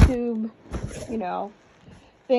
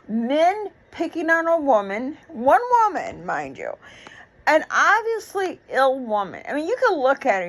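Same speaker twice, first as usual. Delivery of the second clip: normal talking voice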